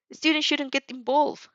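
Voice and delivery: a fired-up rival promising to win; hammed-up hot-blooded boy on the phone; passionate boy. Inasa voiced by reader.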